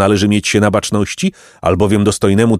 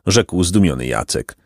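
plain reading voice

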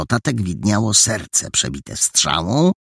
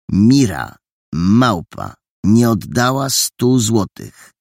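reading out slowly; 5 semitones higher, 5 dB louder, slower than usual. A narrator's reading. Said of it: "Sylabizował".

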